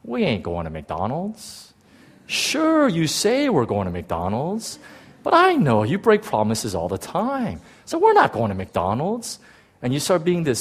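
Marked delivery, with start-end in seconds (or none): 0.0-9.4 drawn-out, doubting sass; mock your parents' promise like a cynical child; slow, sarcastic sing-song disbelief; smart-aleck kid. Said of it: "such a smart-ass little kid".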